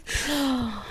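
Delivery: sharp emotional gasp; teary gasp. Real, not acted. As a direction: catch the breath, tearing up. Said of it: "I said I wouldn't get teary".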